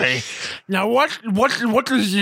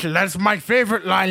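usual speaking voice

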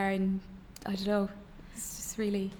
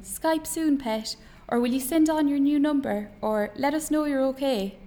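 higher-pitched, lightly voiced mother; mam's email voice; 5 semitones higher, 5 dB louder than usual. Son recalls Mam's emails, lightly voiced mother. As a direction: lightly put on a fussing mam.

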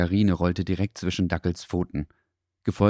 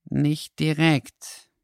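slow, higher dog voice; mumbling dog; 9 semitones higher, much slower than usual. A narrator's reading. Dackel answers the fox, slow and hesitant. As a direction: draw it out hesitantly. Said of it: "murmelte Dackel leise".

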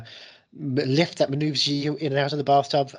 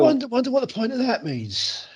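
high-pitched mock old-timer; comic high voice, playfully put on; silly put-on voice; 11 semitones higher than usual. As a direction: complain about being old in a silly high voice. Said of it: "in that voice".

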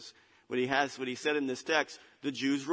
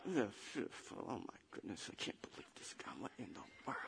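very low, barely audible disbelieving mutter; grumbling under breath; 4 semitones lower, 15 dB quieter than usual. Acted. Crowd grumbles about Jesus's claim, muttering under the breath. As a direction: grumble it to yourself, almost voiceless. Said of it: "they grumbled under their breath".

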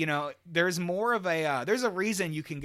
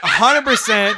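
loud, higher, through real laughter; laughing insistence; 6 semitones higher, 13 dB louder than usual. Real, not acted. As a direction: insist emphatically while cracking up. Laughing hard while insisting.

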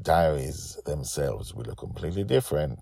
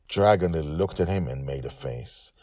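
usual speaking voice

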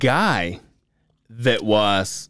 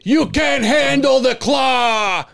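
slow, high dramatic shout; booming announcer; 12 semitones higher, 3 dB louder, slower than usual. Retold or imitated. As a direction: proclaim it like a movie trailer. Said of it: "and he goes".

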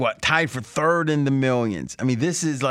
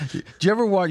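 ordinary delivery

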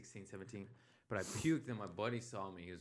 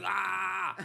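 loud high retching "aah"; Exorcist puking scream; 9 semitones higher, 7 dB louder than usual. Retold or imitated. Comic retelling of puking past a family, loud puking yell. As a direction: yell it out like possessed vomiting. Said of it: "puked like Linda Blair in The Exorcist".

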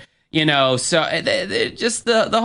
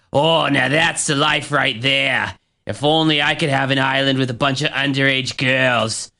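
bad northern accent. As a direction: ham up a cocky Massachusetts boss, accent overdone. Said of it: "doing a pretty bad northern accent right now".

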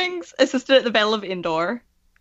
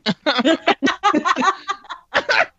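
clucking like a hen; 9 semitones higher than usual. Real, not acted. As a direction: laugh that clucks like a chicken. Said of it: "That's the great chicken noise".

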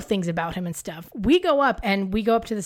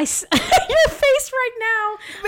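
laughing; 12 semitones higher, much slower than usual. Real, not acted. Laughing at friend's face.

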